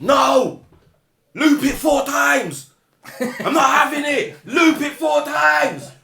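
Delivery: high, drawn-out demanding shout; loud, stretched-out mock scream. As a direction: imitate a worked-up rapper screaming orders. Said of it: "Started screaming".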